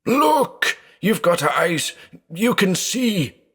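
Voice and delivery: very high, desperate yell; frantic shouting. Acted. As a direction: beg him to look, wild with fear.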